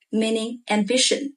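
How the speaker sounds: ordinary delivery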